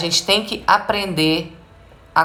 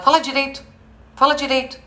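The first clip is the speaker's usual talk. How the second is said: high-pitched imitated reprimand; scolding parent; 8 semitones higher, faster than usual. Parent to a child who speaks jumbled, mock scolding. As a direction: repeat it like a nagging parent. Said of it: "A criança tá falando embolado".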